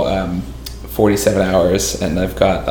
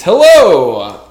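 big booming stage magician hello; 9 semitones higher, 9 dB louder than usual. Acted. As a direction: greet the crowd like a showman. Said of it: "Magician voice".

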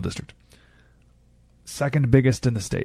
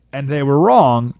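usual speaking voice

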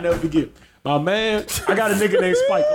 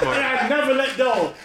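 normal talking voice

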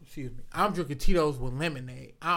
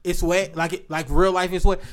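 ordinary talk

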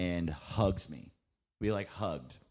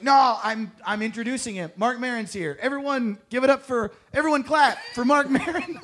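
much higher, louder pleading announcement; frantic hype; 14 semitones higher, 9 dB louder than usual. Retold or imitated. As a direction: beg the crowd to cheer, overexcited. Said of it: "I was like".

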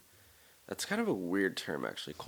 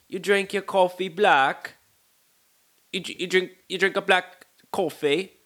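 high, loud, incredulous fake British voice; mock a shocked British friend; horrible British accent; 10 semitones higher, 8 dB louder than usual. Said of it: "sorry for this horrible accent".

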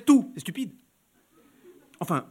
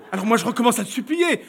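ordinary delivery, nothing marked

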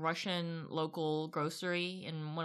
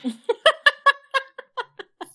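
burst of laughter; 16 dB louder than usual. Real, not acted. Laughing at retold grocery line exchange.

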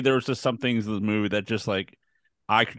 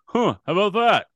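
nonchalant shrug; 9 semitones higher, 4 dB louder than usual. Retold or imitated. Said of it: "He goes".

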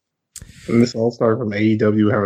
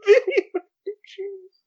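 disbelieving repeat. Real, not acted.

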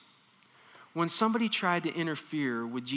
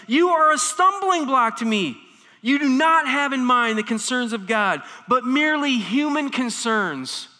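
louder, higher stern rebuke; intense rebuke; 6 semitones higher, 6 dB louder than usual. Retold or imitated. Jesus rebuking Peter, loud and stern. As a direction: correct him forcefully. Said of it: "the same intensity".

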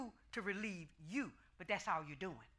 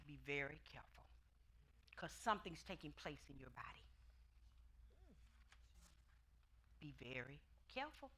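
soft warning; 6 semitones lower, 14 dB quieter than usual. Retold or imitated. God's warning voiced softly.

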